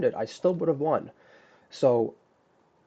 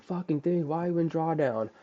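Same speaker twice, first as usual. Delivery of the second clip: higher, half-joking curse at the screen; hammed-up rant at the chart, voice pitched up; mock angry cursing; 7 semitones higher than usual. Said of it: "and I was saying".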